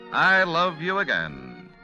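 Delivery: ordinary delivery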